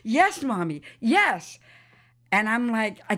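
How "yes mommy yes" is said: retold eager yes, punched out louder; animated child. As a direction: imitate a daughter thrilled to be understood. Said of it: "Katrina became very animated".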